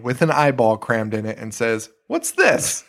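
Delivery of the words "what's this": high, squeaky mock puzzlement; puzzled what's this. A bystander staring at the brain, asks in high mock puzzlement. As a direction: pitch it way up, baffled. Person finds eyeball brain.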